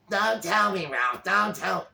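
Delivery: high, loud and slow, a put-on grating wife; grating Alice voice. Wife nagging Ralph, a high loud grating whine. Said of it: "She's got a grating voice".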